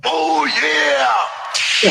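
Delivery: drawn-out "oh yeah" holler; Macho Man yell. Acted. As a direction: yell it like a wrestling hype man. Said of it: "yell at someone like".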